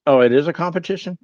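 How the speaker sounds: mock surprise. Real, not acted. Reacting to chat teasing.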